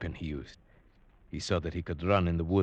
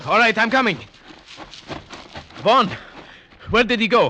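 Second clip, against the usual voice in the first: loud, high, breathless calling; urgent shout; 8 dB louder than usual. Acted. Man chasing the killer into the dark, calling urgently. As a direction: shout after him as you give chase.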